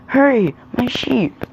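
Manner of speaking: high, slow, drawn-out plea; urgent plea. A narrator's reading. Boy urging villagers to help.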